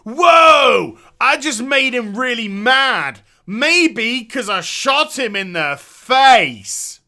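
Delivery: loud, slow mock shout of the dumb line; mocking Liam. Gamer mocking Liam's line, loud hammed-up shout. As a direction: ham up the character's goofy outburst. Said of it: "And Liam goes".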